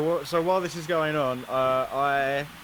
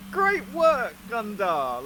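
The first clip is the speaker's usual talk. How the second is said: high, loud sarcastic praise; mock praise, dripping with sarcasm; 7 semitones higher, 3 dB louder, much slower than usual. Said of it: "but in really sarcastic tones".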